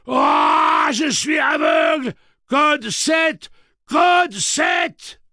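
slow, high screaming rage; enraged robot shout. A narrator's reading. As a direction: roar every word at full force, drawn out. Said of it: "Cette voix n'avait rien de commun".

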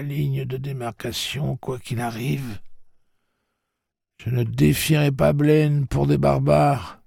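put-on proud old woman facing her death; defiant old woman. Maud defiantly refuses to go on.

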